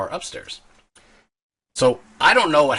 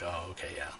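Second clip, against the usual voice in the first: put-on meek drunk mumble; 5 semitones lower, 18 dB quieter, faster than usual. Meekly agreeing, drunk and done.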